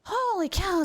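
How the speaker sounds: usual speaking voice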